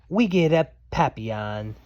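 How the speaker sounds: usual speaking voice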